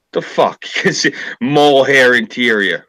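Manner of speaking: laughing outrage at the snob; incredulous. Real, not acted. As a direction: pitch up in mock disbelief. Incredulous at mohair complaint.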